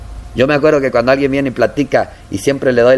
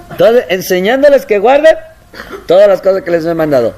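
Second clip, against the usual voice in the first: louder, high preaching; forceful preaching; 6 semitones higher, 4 dB louder than usual. Acted. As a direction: proclaim it to the congregation. (predicación enérgica)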